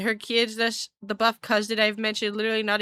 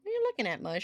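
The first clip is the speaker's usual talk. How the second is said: sweet pet-talk voice; 4 semitones higher, 6 dB quieter, much faster than usual. Real, not acted. Cooing to her cat.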